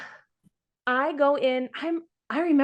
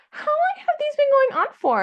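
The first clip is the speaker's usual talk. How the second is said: a sharply higher, louder nurse voice asking; high nurse question; 6 dB louder than usual. Retold or imitated. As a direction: mimic the nurse, pitched well up. Nurse quoted at the check.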